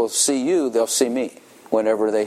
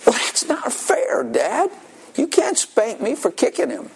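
put-on protesting little girl; 12 semitones higher than usual. Daughter protests to dad.

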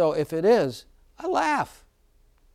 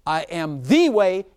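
"the" leaned on, slow and louder; stress on THE; 2 semitones higher, 5 dB louder, slower than usual. Shown as a demonstration. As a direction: hit the word "the" in "I am the way". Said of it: "I emphasize the word, the definite article".

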